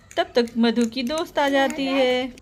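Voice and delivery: ordinary delivery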